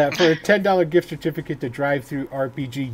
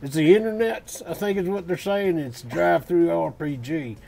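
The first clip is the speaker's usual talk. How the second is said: cowboy voice. Acted. Host reading the giveaway on request in a cowboy accent. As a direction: ham up the old-timer cowboy who calls it the internet. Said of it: "Do the voice".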